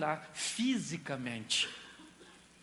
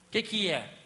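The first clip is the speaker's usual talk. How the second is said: curt reply; slower than usual. Retold or imitated. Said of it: "como é que você fala?".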